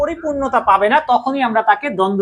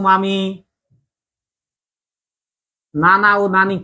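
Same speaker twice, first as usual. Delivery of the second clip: slow example list; 4 semitones lower, slower than usual. Shown as a demonstration. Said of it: "teacher lists examples slowly".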